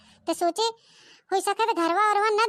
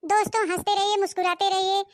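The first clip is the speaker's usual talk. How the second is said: ordinary delivery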